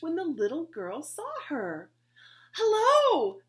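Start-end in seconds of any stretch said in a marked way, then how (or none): 2.6-3.4 high, loud friendly call across the street. Acted. Betsy calling to the new girl, loud and high. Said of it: "called Betsy".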